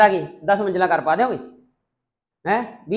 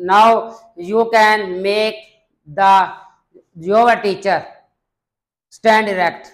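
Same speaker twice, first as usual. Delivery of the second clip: slow, louder, carefully paced English; model speech demo; 4 semitones higher, 9 dB louder, much slower than usual. Shown as a demonstration. Teacher models an English answer for students, slow formal delivery. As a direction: read it out as the model answer for the class.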